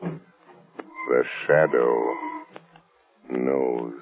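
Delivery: very slow, low and drawn out; ominous pronouncement. Acted. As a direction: stretch each word into a sinister warning. Shadow's ominous parting line.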